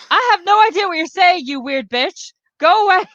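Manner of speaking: high-pitched, baffled brush-off; acted exasperation. Barista to incomprehensible customer, acted exasperation. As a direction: send the weirdo away, exasperated.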